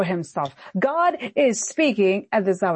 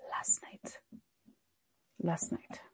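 whisper; 3 semitones lower, 16 dB quieter, slower than usual. Acted. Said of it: "repeated in a hush".

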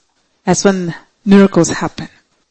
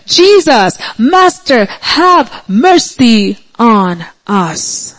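crying out; 2 semitones higher, much slower than usual. Retold or imitated. Lepers pleading with Jesus for healing, crying out. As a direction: call out in earnest prayer, drawing out the ending. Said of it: "They cried out loudly".

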